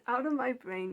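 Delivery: emphatic yelled command; loud banishment. Retold or imitated. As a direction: throw them out with force. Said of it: "I just say".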